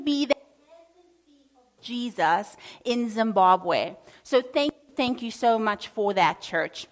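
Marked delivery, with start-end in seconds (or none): none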